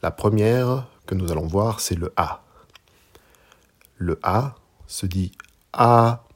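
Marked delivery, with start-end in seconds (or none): 5.7-6.2 A held high and perfectly level; first tone on A. Shown as a demonstration. Teacher showing learners Mandarin A, high level tone. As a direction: say A loud, long, high and even. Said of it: "Avec le premier ton".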